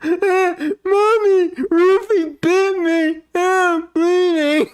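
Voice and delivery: high yelping cries for mom; pained kid wailing. Retold or imitated. Kid bitten by his dog wails to his mom, high and loud. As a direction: wail it like a bitten crybaby. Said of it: "He goes".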